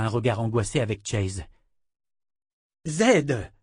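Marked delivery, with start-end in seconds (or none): 2.9-3.5 higher-pitched urgent call; alarmed cry. A narrator's reading. Woman shaking the unresponsive wizard, crying out. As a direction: cry out the name to rouse him. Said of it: "cria-t-elle en le secouant".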